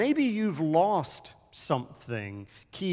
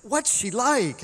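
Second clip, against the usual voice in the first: high, slow, eager question; a tourist leaning in, thrilled; excited; 10 semitones higher, 6 dB louder, slower than usual. Retold or imitated. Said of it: "they asked him very excitedly".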